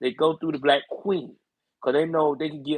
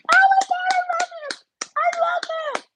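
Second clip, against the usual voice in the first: squeaky delight; 3 dB louder, slower than usual. Acted. Savoring his own impression.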